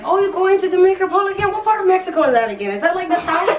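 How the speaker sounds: high, fast, incredulous boss voice; lilting mock of a clueless boss, quick and high. To her coworkers, mimicking her boss asking about Mexico, high and fast. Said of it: "my boss would be like".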